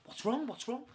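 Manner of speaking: high-pitched retold concern; concerned questioning. Finding the flatmate rocking back and forwards, concerned.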